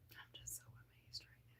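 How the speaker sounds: breathless whisper, fast; amazed whisper. Real, not acted. Vlogger overwhelmed by new members, whispering. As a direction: hush it out in disbelief.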